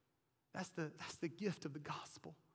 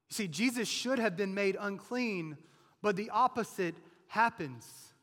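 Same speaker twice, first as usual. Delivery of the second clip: loud, driving sermon delivery, higher pitch; full-force preaching; 4 semitones higher, 12 dB louder than usual. Acted. Preacher to congregation about the leper's isolation, full-force preaching.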